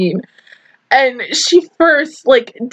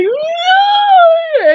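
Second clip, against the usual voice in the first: mock wail. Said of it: "And I was like".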